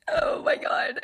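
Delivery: whispered, no voice; stunned whisper after laughing; breathless whisper. Real, not acted. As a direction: gasp it out unvoiced. Overwhelmed, breathes out.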